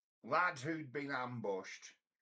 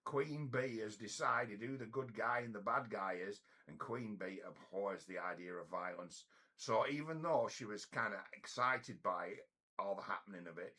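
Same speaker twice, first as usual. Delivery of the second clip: usual speaking voice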